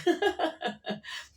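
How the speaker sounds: short high giggle that falls away; a real burst of laughter fading out; amused laugh. Real, not acted. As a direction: laugh at how silly she looks. Laughing at prehistoric-looking chicken.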